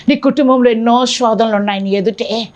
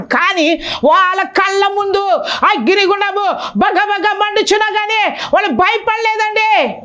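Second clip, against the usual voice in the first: raised, driving sermon voice; impassioned preacher; fervent preaching; 5 semitones higher than usual. Acted. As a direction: preach it out to the congregation. Preaching at full force.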